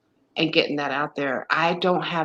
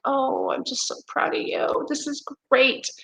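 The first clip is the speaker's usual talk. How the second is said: proud praise; 9 semitones higher than usual. Retold or imitated. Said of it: "I'd be like".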